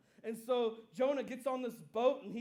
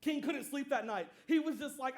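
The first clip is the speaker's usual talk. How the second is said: ordinary delivery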